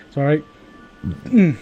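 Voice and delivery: usual speaking voice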